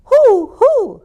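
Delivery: two high, hollow hoots; owl hoot. A narrator's reading. Owl flying over the stable, high hoots. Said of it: "hooted Owl".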